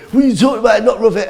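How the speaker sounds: high, loud imitation of a heckler; heckler shouting. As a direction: mimic a drunk shouting at the act. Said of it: "this guy starts shouting".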